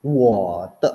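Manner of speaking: WO falls low with no rise, then TE; low half third tone. Shown as a demonstration. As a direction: demonstrate a low falling WO, said firmly. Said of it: "sebutnya rendah doang kan".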